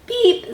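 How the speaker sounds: very high, short toot; truck horn beep. A narrator's reading. Blue greets a big green toad, high horn beep. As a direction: beep like a friendly little truck. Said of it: "said the little, said Blue".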